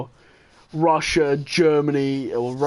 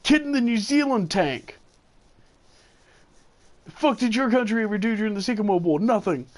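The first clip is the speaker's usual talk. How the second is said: higher-pitched mock indignation; retold heckler; 7 semitones higher than usual. Irate player pinging a New Zealand tank, mock indignant. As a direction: mimic an irate gamer calling someone out. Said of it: "somebody's pinging me going".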